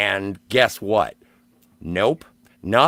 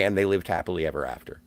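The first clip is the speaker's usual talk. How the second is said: fairy-tale ending; 6 semitones lower, 6 dB quieter, faster than usual. Retold or imitated. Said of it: "Please say".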